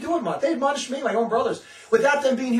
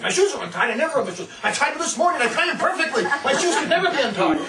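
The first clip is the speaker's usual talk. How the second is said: higher, indignant voice with laughter; hammed-up offended denial; mocking denial; 6 semitones higher, 4 dB louder than usual. Mocking someone who insists his shoes are tied.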